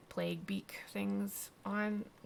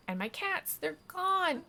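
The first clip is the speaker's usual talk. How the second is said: hammed-up distress; 11 semitones higher, 4 dB louder, slower than usual. Cat lady tells Wake of lost cats, acted distress. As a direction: voice climbing high and louder.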